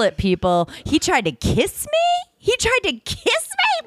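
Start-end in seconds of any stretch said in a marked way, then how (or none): 0.8-2.3 higher, slow, incredulous question; sassy disbelief. Acted. Telling her co-host he tried a kiss, sassy and higher. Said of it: "The sass meter is off the chart". 2.4-3.9 much higher, slow, shouted outrage; sassy shout. Acted. Repeating to her co-host that he tried a kiss, a sassy shout. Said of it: "The sass meter is off the chart".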